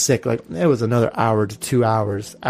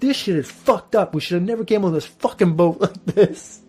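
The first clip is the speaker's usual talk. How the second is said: a mild mock yell, pitched higher; retold yell; 5 semitones higher than usual. Seasick on a rough boat tour, a mild mock yell at her husband. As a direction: half-shout the complaint, seasick and fed up. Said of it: "I yelled it".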